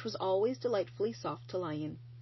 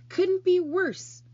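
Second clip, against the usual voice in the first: emphatic; 11 semitones higher, 9 dB louder, much slower than usual. Acted. Charlotte rejects the ad.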